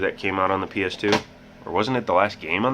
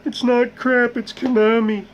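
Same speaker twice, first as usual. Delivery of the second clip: put-on very high, louder old lady defending the game; mock grandma voice; 5 dB louder than usual. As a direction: squeak it out like an indignant grandma. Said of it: "Shut up, Grandma".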